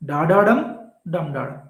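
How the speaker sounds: "Da da dum" up to a strong end, "dum da da" dropping; alternating stress. Shown as a demonstration. Teacher giving a memory aid, mirrored stress in "Da da dum, dum da da". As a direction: punch the end of "Da da dum", then drop for "dum da da". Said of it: "How to remember?".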